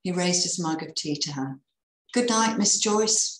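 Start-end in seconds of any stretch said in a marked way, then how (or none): 2.1-3.4 higher-pitched, drawn-out friendly call. A narrator's reading. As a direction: call out a good night, slowly. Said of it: "he called".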